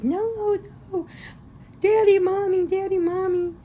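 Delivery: put-on high, frantic little-girl cry; mock panicked plea. Daughter begging over her tied-up parents, high and frantic. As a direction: squeal it like a terrified girl.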